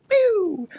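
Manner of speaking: high, sliding "pew" noise; vocal sound effect, high-pitched pew; imitated zap sound. Imitated noise as picture appears.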